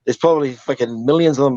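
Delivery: ordinary talk, nothing marked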